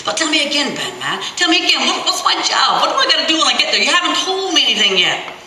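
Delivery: put-on high, fast, pleading child's voice; eager pleading kid. A narrator's reading. Boy Chris begging, high excited voice.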